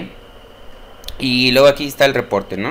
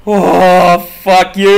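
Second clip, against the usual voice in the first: startled swear; 5 semitones higher, 6 dB louder, much slower than usual. Real, not acted. Shouting at the scare.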